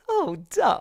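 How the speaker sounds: high, loud put-on dopey voice; mock ditzy voice. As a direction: play it airheaded. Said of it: "I'm more like".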